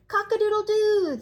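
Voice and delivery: high, loud rooster crow. Acted. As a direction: belt out a crow to the dawn. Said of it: "crowing to the dawn".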